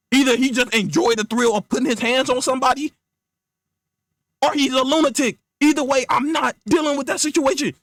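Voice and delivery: high-pitched, agitated refusal; exasperated rant. Acted. Friend refusing to fight Batman, exasperated rant. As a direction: rant your way out of it, then cut them off.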